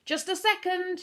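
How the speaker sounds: calling out. A narrator's reading. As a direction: call it out across the house. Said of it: "he called".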